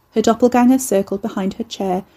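ordinary delivery, nothing marked

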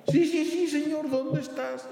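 high, breathless eager answer; eager desperate man. Acted. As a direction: grab at the voice with hope. Man answers God, hanging.

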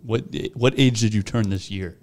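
usual speaking voice

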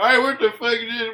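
shouted high, angry customer; loud mock yell. Southern customers acted, yelling.

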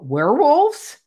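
high, loud rise on WEREWOLVES; mock-surprised WEREWOLVES with a big questioning lift. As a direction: retell it as an astonished question, WEREWOLVES swooping upward. She questions, he confirms.

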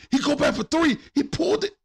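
jumps high as the shot goes up; excited call. Real, not acted. Streamer calling his own shot, excited and high. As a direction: call the three like it's the game winner. Hyped call of a three attempt.

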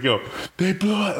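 high-pitched mock yell; retold yelling. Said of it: "he's yelling at him".

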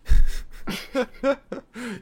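loud high cackle; loud laugh. Real, not acted. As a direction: burst out laughing loudly. Laughing at the Grinch joke.

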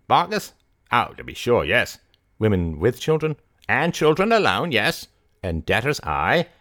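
swooping high on key words; lilting rustic character voice; Barnstable, obliging rustic keeper. A narrator's reading. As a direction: play an obliging country keeper. Voices Barnstable listing the inmates.

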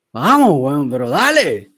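loud, exasperated coach's urging; pushing someone on. Retold or imitated. Trainer pushing slow male clients, loud urging. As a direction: imitate a trainer shoving them forward by voice. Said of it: "empujarlos hacia allá es como".

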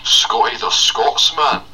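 drawn-out Scottish introduction; bellowed slow Scottish voice; Scotty the Scotsman. Acted. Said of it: "as a Scottish man called Scotty".

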